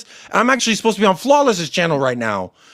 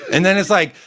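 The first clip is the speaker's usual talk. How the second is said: normal talking voice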